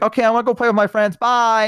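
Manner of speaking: put-on high, quick child's farewell; kid saying goodbye. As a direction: rattle off a kid's cheery goodbye. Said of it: "I'd be like".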